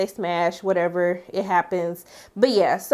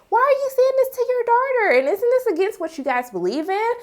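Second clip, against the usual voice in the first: outraged disbelief; 13 semitones higher than usual. Retold or imitated. Recapper scolds Serena's mother for her cruel words, outraged high squeal.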